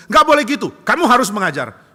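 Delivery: loud and high, demanding; a cheated kid insisting; boy shouting at his teacher to teach; indignant protest. Acted.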